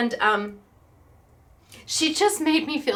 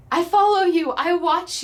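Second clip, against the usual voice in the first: high, loud mock fangirl gush; acting out a fan's delight; imitated excited fan; 6 semitones higher, 5 dB louder than usual. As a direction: gush it like a starstruck follower. Retells an excited fan's greeting.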